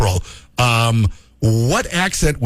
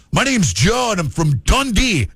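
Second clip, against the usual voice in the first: higher, mock Scottish yell-out; hammed-up Scottish brogue; Scottish accent; 5 semitones higher than usual. Shown as a demonstration. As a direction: announce yourself in a put-on Scottish accent. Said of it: "with a Scottish accent".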